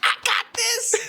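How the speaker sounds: very high-pitched comic impression; George Lopez. Shown as a demonstration. Friend clowning after his Mexican bit, high hammed-up impression. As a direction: squeal it like a sitcom comedian. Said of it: "Fucking George Lopez".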